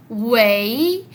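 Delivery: "wei" long and loud, sliding up; tercer tono. Shown as a demonstration. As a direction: draw out "wei" into a questioning rise. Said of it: "Este tercer tono". («wei» en tercer tono, alargado y ascendente)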